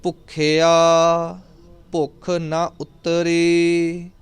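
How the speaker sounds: very slow, sustained chant with long vowels; scripture recitation. Shown as a demonstration. Teacher reciting Japji for students, slow measured chant. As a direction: draw out each line on a level, held tone.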